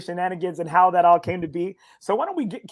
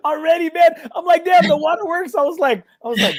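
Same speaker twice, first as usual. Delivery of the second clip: laughing; 10 semitones higher, 6 dB louder than usual. Real, not acted. Host reacts to guest crying.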